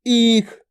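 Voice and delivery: ईख with a long "eee" before the kh; drawn-out vowel. Shown as a demonstration. Teacher gives pupils an example word, long-vowelled ईख. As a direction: stretch the first vowel of ईख. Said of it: "थोड़ा खींच के कर रहे हैं".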